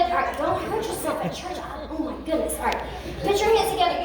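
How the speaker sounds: ordinary talk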